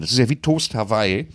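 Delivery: usual speaking voice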